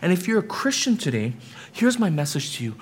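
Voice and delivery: ordinary delivery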